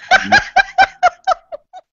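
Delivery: very high, loud burst of laughter; real laughter. Real, not acted. Cracking up with the cohosts, loud high laugh. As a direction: let the laugh explode.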